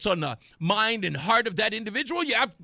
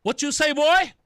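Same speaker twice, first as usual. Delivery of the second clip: confrontational challenge; 6 semitones higher, 4 dB louder than usual. Retold or imitated. Reacting to played clip.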